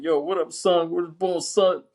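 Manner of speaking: mock Wu-Tang style shout-out; hip-hop greeting. Shown as a demonstration. Showing how son isn't said, hip-hop greeting. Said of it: "in a hip hop way".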